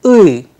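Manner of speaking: loud, clear uy sound; Russian vowel uy. Shown as a demonstration. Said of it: "Let's repeat it once again".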